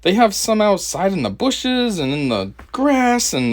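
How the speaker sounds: usual speaking voice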